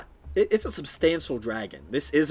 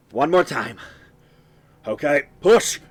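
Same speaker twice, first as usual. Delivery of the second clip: louder calls building to the final push; rallying shout; 2 semitones higher, 7 dB louder than usual. Acted. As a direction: urge the crew on for one last heave. Tiefling urges crew to push.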